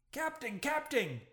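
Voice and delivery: high yell for the captain; panicked yell. A narrator's reading. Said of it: "yelled the bumpkin".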